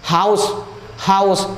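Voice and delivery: हाउस said with the h pushed from the belly; हाउस with a strong belly h; pron demo. Shown as a demonstration. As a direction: breathe हाउस out from the stomach. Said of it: "बोलो, say with me".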